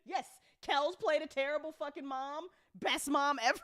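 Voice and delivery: ordinary talk, nothing marked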